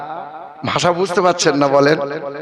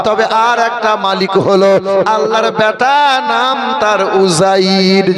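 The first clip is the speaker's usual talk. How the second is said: full-voiced sermon delivery, phrases drawn out; lifted preaching voice with long held lines; 3 semitones higher, slower than usual. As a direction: preach it to the gathering.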